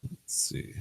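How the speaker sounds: whispered to himself; whispering. Real, not acted. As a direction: breathe it without voice. Muttered to himself.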